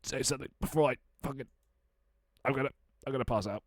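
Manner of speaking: strained and rushed, air running out; squeezed out while holding breath. Real, not acted. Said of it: "I am holding my breath".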